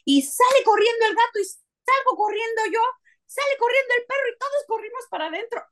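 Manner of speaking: high, quick amused excitement; excited. Retold or imitated. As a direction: tumble out the chaos breathlessly. Laughing retelling of the flight.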